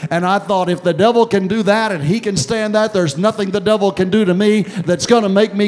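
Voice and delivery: loud, high, driving sermon delivery; full-force preaching. Acted. Preacher rousing the church, loud and high.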